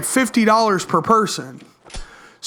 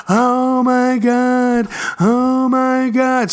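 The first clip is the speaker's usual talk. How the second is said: slow, drawn-out gushing oh my God; excited gasp; 3 semitones higher, much slower than usual. Retold or imitated. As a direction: imitate the girlfriend swooning over the teddy bear. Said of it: "she's getting really excited".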